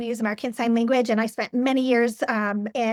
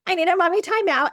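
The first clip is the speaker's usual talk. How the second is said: higher and louder, a bright playful call; playful repeat; 8 semitones higher, 5 dB louder than usual. Retold or imitated. Mom repeating her timeout line to the kids, higher and louder. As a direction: toss the line up high and loud, half laughing. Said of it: "I tell my kids".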